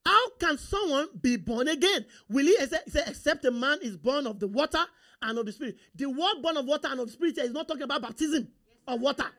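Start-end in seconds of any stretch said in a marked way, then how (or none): none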